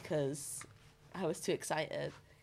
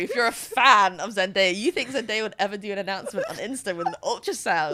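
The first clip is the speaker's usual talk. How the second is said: loud disbelieving rant; incredulous; 9 dB louder than usual. Real, not acted. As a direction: say it like it's absurd. Mocking disbelief at fake announcement.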